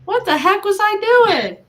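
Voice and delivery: high, loud incredulous self-questioning; mock disbelief. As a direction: exclaim it, baffled at your own past choice.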